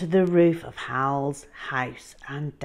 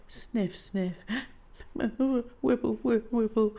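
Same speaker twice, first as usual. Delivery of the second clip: mock dinosaur crying; 7 semitones higher than usual. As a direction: whimper like a sad creature. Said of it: "he started to sob".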